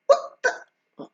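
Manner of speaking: high startled gasp of disbelief; a hint of stunned squeak; shocked disbelief. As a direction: sound utterly thrown. Shocked reaction in dream.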